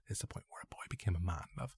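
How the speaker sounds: low, very soft and quick; hushed, hurried retelling; sore-throat excuse. Retold or imitated. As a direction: mumble it quietly, passing it off. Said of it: "I had a really sore throat".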